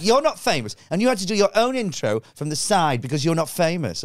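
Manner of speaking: put-on high, sneering kid voice; mock a bratty girl, high up. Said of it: "she went".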